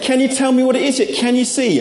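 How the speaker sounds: put-on high, quick stab at an Australian accent; eager, badly faked Australian impression. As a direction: push the pitch up for a rough Australian catchphrase. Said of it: "Can't do the Australian accent".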